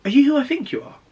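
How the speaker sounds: high-pitched imitation of a curious nurse; retold nosy question, pitched right up; imitated nurse's curiosity. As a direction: lift your voice high to quote her question. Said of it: "And she was like".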